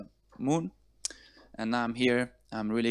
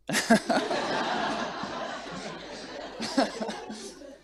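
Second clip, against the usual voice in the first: long nervous laugh, mostly air; embarrassed laugh; 12 semitones higher than usual. Real, not acted. Introducing himself, he loses track and laughs it off. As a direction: laugh off the slip, then recover. Laughs after losing his words.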